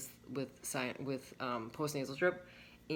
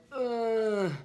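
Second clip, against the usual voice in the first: mock groan of discomfort; 7 semitones higher, 7 dB louder than usual. Retold discomfort after eating.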